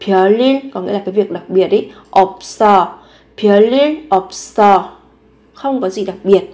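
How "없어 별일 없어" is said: normal talking voice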